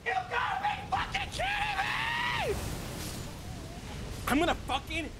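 screaming high, near breaking; rage-yell at the screen; raging gamer. Real, not acted. Losing again to the boss.

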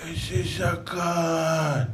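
very slow, stretched amazement; admiring awe. Retold or imitated. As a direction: draw out the wonder. (התפעלות איטית ומתמשכת)

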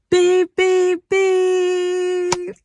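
high, loud honking beeps; imitated car horn beeps. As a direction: mimic a cab horn, last beep held long.

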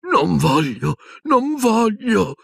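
put-on high, wavering frightened old voice, dragged slow; an old wizard begs in terror; terrified. A narrator's reading. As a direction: whimper it out like a terrified old man. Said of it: "non l'aveva mai sentito così spaventato".

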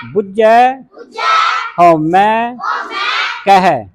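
call-and-response drill. Shown as a demonstration. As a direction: call each word out for a class to echo. Teacher leading a repeat-after-me drill.

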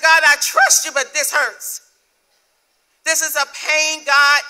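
loud, high cry to God; anguished plea. Retold or imitated. A hurting believer answers God, loud and anguished. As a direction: cry it out as a pained prayer. Anguished prayer retold at full force.

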